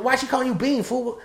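high, suspicious demand; gangbanger voice. Retold or imitated. Gangbanger demands to know about the nickname, high and suspicious. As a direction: play a puzzled gangbanger pressing for answers. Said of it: "gangbanger to my right goes".

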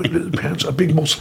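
ordinary delivery

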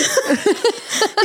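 sudden high burst of laughter. Real, not acted. Friend laughs at the shocking video, high burst of laughter.

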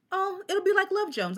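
high, giddy rush; giddy excitement. Retold or imitated. Young poet imagines open mic like movie, giddy. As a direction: squeal with movie-fed hope.

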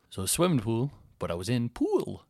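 normal talking voice

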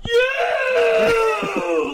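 high, loud, raw shout; shout at kid. Retold or imitated. Drunk friend shouting at little kid, raw shout. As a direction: scream the word at the kid. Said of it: "Like that".

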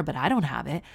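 usual speaking voice

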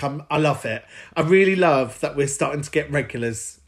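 ordinary delivery

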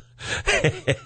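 high breathy laugh. Real, not acted. Laughing at watch line.

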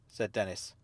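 ordinary delivery

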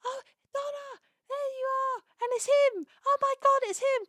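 put-on high, overjoyed elderly voice; excited old grandad. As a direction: squeal like a thrilled grandad spotting the blue box. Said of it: "he sees the blue box and goes".

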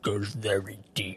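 whispered deep, dragged-out bass; deep, hushed dragon-like voice. Acted. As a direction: drop low into a slow, breathy rumble. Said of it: "He had a great voice".

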